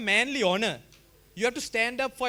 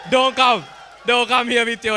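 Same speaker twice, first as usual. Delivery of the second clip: mock taunting shout; 5 dB louder than usual. Mock taunt at rival school.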